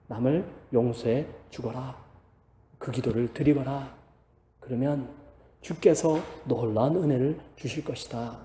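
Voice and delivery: soft, low voice of the Lord speaking; gentle command. Acted. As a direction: let it fall quiet and tender, like a father. Voicing the Lord's gentle command.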